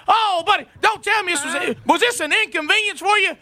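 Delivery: husband mocks the installer who wasted his Saturday; sarcastic mock sweetness. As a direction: rub it in with fake politeness. Mocking the late installer.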